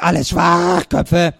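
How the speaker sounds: slow, drawn-out parrot croak; raspy bird voice, stretched out. A narrator's reading. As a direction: squawk the insult like a parrot. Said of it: "krächzte der Papagei".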